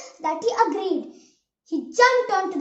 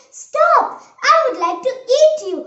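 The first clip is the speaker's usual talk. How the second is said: high, loud storybook shout; calling out; 7 semitones higher, 9 dB louder than usual. A narrator's reading. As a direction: shout a halt to someone escaping. Hungry pig calls out to gingerbread man.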